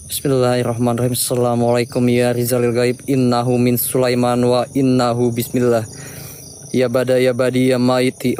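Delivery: low, hushed ritual chant. Real, not acted. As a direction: murmur the summoning words. Low quiet summoning. (mantra pemanggilan dengan suara rendah)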